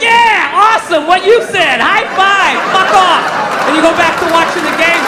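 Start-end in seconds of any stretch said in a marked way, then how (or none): 0.0-3.2 higher and louder fake enthusiasm; mock dismissive agreement. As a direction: agree loudly just to get rid of them.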